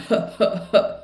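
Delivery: quick breathy "ha" puffs; unvoiced belly huffs; huffed H sounds. Shown as a demonstration. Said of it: "you can hear the difference between".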